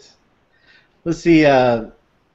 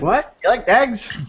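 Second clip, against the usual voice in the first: higher-pitched mock Irish Traveller question; baffled repeat in pikey brogue; mimicked pikey exchange; 9 semitones higher, slower than usual. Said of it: "not being able to understand a single word".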